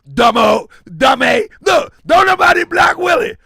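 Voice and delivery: ordinary talk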